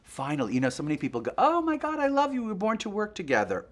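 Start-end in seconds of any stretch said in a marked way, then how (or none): 1.4-3.7 very high, loud fan squeal; mock gushing fan. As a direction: imitate an overexcited admirer. Gushing fans acted out.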